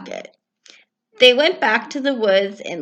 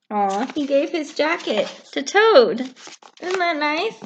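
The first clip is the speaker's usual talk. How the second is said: tender aside; 7 semitones higher, slower than usual. Real, not acted. Narrator aside to children.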